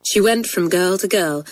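plain reading voice

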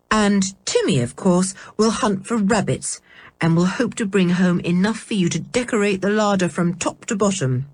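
plain reading voice

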